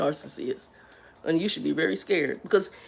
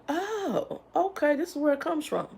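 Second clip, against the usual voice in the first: high-pitched oh of discovery; high dawning realization; 10 semitones higher, 5 dB quieter than usual. Retold or imitated. Vlogger retelling her realization, high and surprised. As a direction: let it dawn on you. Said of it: "I'm like".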